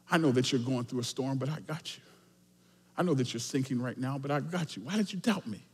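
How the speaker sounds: low, hushed, intimate comfort; soft reassurance. Retold or imitated. Jesus tells sinking Peter I got you, softly reassuring. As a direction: drop right down and reassure gently.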